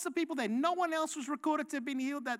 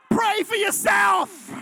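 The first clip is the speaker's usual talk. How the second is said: higher, louder, slow shouted retort; exasperated shout; 7 semitones higher, 12 dB louder, slower than usual. Acted. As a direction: bark it back at the excuse. Snaps at excuse-making congregant.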